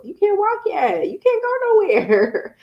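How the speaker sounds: put-on high, teasing sing-song to a baby, slowed on the second line; playful baby talk. Mom teasing her baby son that he can't go anywhere, high sing-song. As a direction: tease the little one who can't walk yet.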